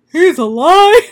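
high, loud dramatic cry; excited shout. Retold or imitated. Charles reacts as Ward knocks on the door, mock excited shout. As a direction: belt it out, hammed-up. Character's cry acted out in retelling.

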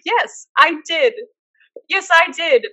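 high, emphatic self-vindication; defiant retort. Retold or imitated. Guesser answering her outraged friend, acted defiant retort. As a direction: stand your ground, pitched high.

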